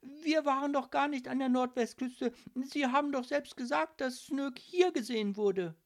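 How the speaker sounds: high, urgent protest; horrified. A narrator's reading. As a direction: plead innocence in shock. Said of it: "fragte Lilith entsetzt".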